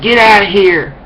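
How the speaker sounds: high, loud mock yell; imitated zombie-like woman. Speaker imitating woman on the road, mock zombie yell. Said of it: "she's going like this".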